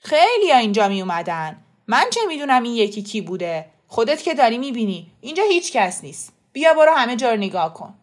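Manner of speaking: loud, exasperated denial; defensive protest. A narrator's reading. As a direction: insist loudly that there's nothing to hide. Shopkeeper loudly defending himself. (دفاع از خود با صدای بلند)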